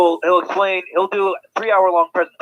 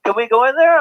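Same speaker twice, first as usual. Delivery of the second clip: imitating an eager kid, pitched higher and louder; kids asking eagerly; 7 semitones higher, 5 dB louder than usual. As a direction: play the curious child begging to come in. Kids asking to enter tent.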